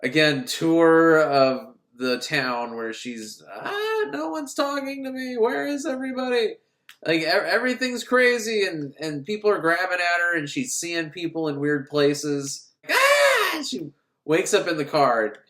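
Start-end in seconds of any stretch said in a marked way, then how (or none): 3.5-6.6 lost woman. Retold or imitated. Woman wandering lost in town. 12.9-13.6 sharp high shriek of waking from a nightmare; startled scream. Retold or imitated. Nightmare, she wakes up.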